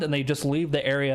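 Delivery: ordinary delivery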